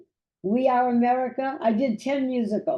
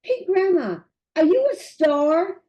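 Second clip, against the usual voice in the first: put-on higher, bright child voice asking with wonder; voicing her great granddaughter; 6 semitones higher, 3 dB louder than usual. Great granddaughter asks grandma if she's a star, in a higher imitated child voice. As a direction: play the little girl gazing up at grandma. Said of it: "my great granddaughter goes".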